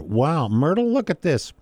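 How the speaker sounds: high, gushing "Wow, Myrtle"; husband dazzled by fake gem, high excited voice; excited tourist. Tourist calls to wife.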